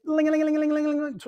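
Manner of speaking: high playful ling ling mimicking a bell; dinner bell. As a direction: ring it like a dinner bell calling everyone in. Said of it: "Let's ring the dinner bell".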